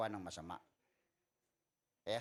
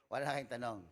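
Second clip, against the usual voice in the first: ordinary delivery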